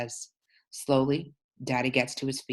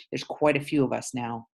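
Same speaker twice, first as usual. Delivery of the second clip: ordinary delivery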